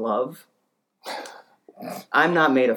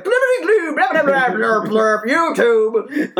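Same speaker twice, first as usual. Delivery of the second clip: loud, high gibberish yelling; garbled screaming; 13 semitones higher, 6 dB louder than usual. Retold or imitated. Neighbour garbling orders at Xbox, screaming. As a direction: yell nonsense syllables as an incomprehensible command. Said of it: "he sounds like".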